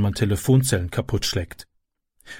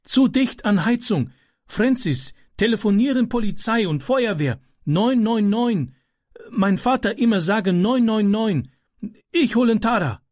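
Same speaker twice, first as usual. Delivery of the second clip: put-on a foreign boy giving orders in a panic; young Ram, urgent and halting; Ram, urgent, broken German; 9 semitones higher than usual. A narrator's reading.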